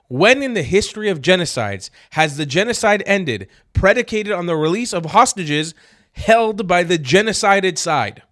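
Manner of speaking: loud, high, furious disbelief; exasperated rant. Real, not acted. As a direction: shout it to the heavens in outrage. Ranting outrage at announcement.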